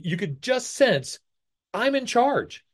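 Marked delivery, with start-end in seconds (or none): 1.7-2.6 high, haughty imitation of defiance; mock defiant. Witness walking into court, lightly mocked as haughty. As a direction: say it like the room is yours. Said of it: "she walks in and she's like".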